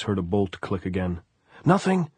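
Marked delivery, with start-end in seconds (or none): 1.6-2.1 high, loud call; raised, much higher voice; shouting. A narrator's reading. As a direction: shout it back in hasty denial. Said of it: "he shouted".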